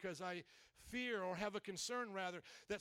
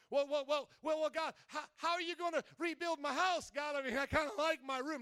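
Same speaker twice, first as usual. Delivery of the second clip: higher, stammering protest; flustered homeowner; 5 semitones higher than usual. Acted. Homeowner pleads with God about his room, flustered and higher. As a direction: stammer out a worried objection to God. Homeowner balking at God's rebuild.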